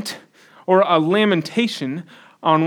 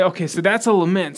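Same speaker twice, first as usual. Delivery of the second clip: usual speaking voice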